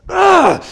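loud, high burst of frustration; reenacted roar of humiliated frustration; frustrated yell. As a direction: let out a loud wordless "Ugh!". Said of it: "so humiliated and so frustrated, I just went".

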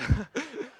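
high burst of laughter. Real, not acted. Laughs at his own quote.

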